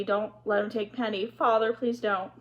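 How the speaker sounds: ordinary delivery, nothing marked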